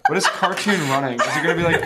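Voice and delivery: laughing. Real, not acted. Laughing at sound effects.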